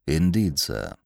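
deferential butler. Acted. Jeeves speaks.

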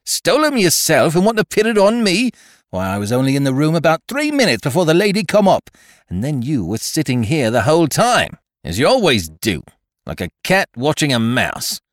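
high, loud common chambermaid tirade; angry tirade. A narrator's reading. Chambermaid accusing the French maid before the inspector, angry. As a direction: spit the accusation out.

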